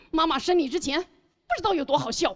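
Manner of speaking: hammed-up reproachful mother; mock guilt-tripping. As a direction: lay a playful guilt trip on your child. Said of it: "我可能会去道德绑架我的孩子". (假装道德绑架孩子的妈妈口吻)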